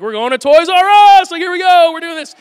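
high, booming game-show giveaway announcement; Oprah impression. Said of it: "say that like Oprah".

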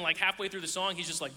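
ordinary talk

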